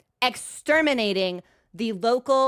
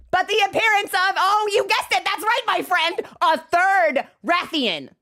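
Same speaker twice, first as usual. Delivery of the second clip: dramatic reveal; 8 semitones higher than usual. Acted. Telling the audience about a third monster, with mock drama. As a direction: unveil it like a game-show twist.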